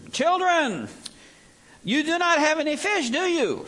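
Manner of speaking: high, loud call across the water. Acted. Jesus calls from the beach to the boat, high loud call. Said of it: "Jesus therefore said to them".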